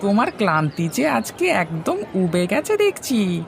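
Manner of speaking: put-on a younger villager speaking up to an older man; second villager. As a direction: pitch up to play another villager. Same higher villager voice.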